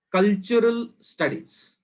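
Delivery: Cultural slowed and pressed hard, falling; stress on cultural. Shown as a demonstration. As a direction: lean deliberately on Cultural. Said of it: "Emphasis on the word cultural is intended".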